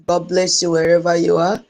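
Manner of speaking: ordinary delivery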